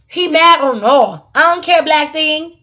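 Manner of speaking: taunting defiance. Real, not acted.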